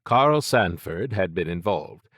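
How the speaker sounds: usual speaking voice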